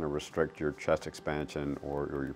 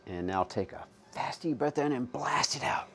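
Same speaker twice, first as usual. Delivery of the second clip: higher, urgent breathing coach; coaching cue; 6 semitones higher, 3 dB quieter than usual. Real, not acted. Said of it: "I'll still coach you to keep blowing".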